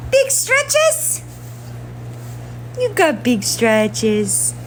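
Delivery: squeaky sing-song pet talk. Real, not acted. Baby talk to the cat.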